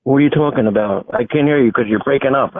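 ordinary talk, nothing marked

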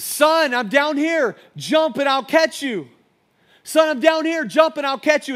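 loud, high-pitched shout up to the roof; father yelling out. Acted. Father calls up to his son on the burning roof, yelling. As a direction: yell it out like a dad desperate to be heard. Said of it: "he begins to yell out to his, his son".

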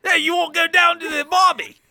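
put-on high, shouted American accent gone Australian; fake American accent. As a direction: holler in a hopelessly botched American accent. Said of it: "Do American".

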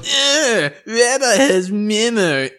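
put-on high, slow, whiny child voice; Ralph Wiggum voice. Cohost echoing the Ralph line, whiny childish. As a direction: squeak it out like a dopey kid.

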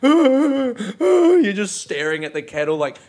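ordinary talk

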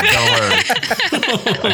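words through laughter; laughing. Real, not acted. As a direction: laugh while reassuring. Said while laughing.

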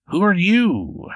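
high, drawn-out creature voice; Bigfoot voice. Acted. Bigfoot questions the stranger at his table, slow high creature voice. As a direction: lift the pitch and stretch the question out. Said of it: "he pulls the chair back and he goes".

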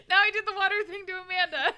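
high, fast, laughing through the words. Real, not acted. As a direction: giggle it out, barely holding it together. Laughing while quoting.

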